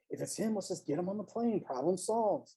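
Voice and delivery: a hushed urgent aside between two men; under the breath. A narrator's reading. Dee muttering to his companion, hushed and urgent. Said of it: "bickering under their breath".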